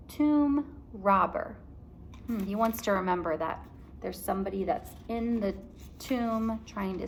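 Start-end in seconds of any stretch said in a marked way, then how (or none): 0.1-1.6 slow, spelled-out words; dictating slowly. Shown as a demonstration. Teacher dictating to class, slow and clear. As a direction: dictate for writing. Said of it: "Can you write that".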